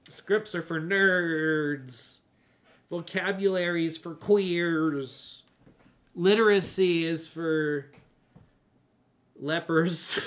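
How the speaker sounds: slow, loud, swaggering declarations; mock macho. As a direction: bark it out like a bro. Mock-tough slogans, slow and loud.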